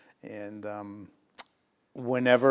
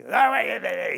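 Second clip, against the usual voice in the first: mock scream; 10 semitones higher, 4 dB louder than usual. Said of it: "he starts with his, you know, screaming".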